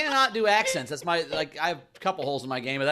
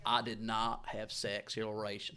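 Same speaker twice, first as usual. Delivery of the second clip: slow, low, hushed politician; Bill Clinton; 8 semitones lower, 10 dB quieter, slower than usual. Shown as a demonstration. Comic attempts a requested impression, slow and low. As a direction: draw it out low and soft. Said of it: "Can we hear your Bill Clinton?".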